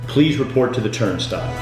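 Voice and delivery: ordinary talk, nothing marked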